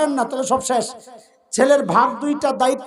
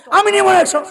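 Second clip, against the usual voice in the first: high, loud mock child's protest; hammed-up child's outburst; acted indignant child; 7 semitones higher, 5 dB louder than usual. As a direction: protest like a child throwing a fit. Retells the child's refusal and demand.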